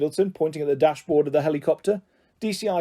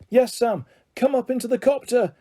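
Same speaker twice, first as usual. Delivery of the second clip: high, urgent call upward; shouting; 8 semitones higher than usual. Acted. Charlie shouting down to his son Sam, urging. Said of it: "shouted Charlie".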